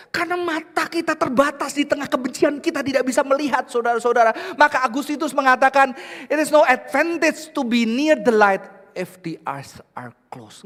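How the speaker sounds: high, loud preaching; preaching at full force. Acted. Pastor quoting Augustine to the church, loud. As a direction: build the point up.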